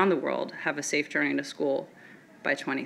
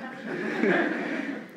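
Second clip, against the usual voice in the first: laugh; 3 semitones higher, 5 dB quieter than usual. Real, not acted. Laughs at own impossible dream.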